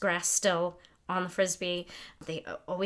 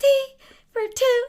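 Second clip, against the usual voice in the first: high, loud sung imitation of a friend; imitating Stacey; 10 dB louder than usual. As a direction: belt it out much higher, like Stacey. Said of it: "I sound like Stacey".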